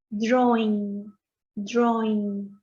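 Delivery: "drawing" with an open o, like dro-ing; open o sound. Shown as a demonstration. Teacher models drawing for students. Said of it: "se lee como una O".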